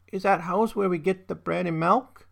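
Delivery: put-on high little-girl voice; toddler Hilda. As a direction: ask hopefully like a small child. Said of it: "Hilda is a toddler".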